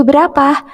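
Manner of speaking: "Berapa?" pitched high as a question; question tune. Shown as a demonstration. Teacher models the question word for pupils, high asking tune. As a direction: lift "Berapa?" like asking a child. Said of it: "dibaca dengan intonasi yang tepat".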